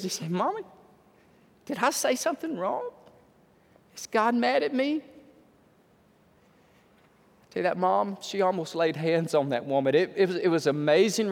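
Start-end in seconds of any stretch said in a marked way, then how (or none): 0.3-5.0 small, soft voice imitating a hurt child; tearful little boy. Little boy asking his mommy if he did wrong, tearful. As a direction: ask timidly, softly, lifting at the end. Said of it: "Little boy's eyes welled up with tears".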